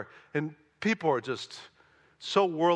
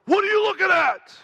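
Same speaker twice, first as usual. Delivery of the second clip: much higher, much louder mock yell; retold screaming; 9 dB louder than usual. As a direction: bellow it like the angry man on the subway. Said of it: "he starts screaming at me".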